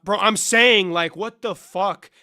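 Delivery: loud, slow incredulous what the fuck; loud exasperated outburst; outraged. Real, not acted. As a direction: blast your disbelief. Outraged recounting debate.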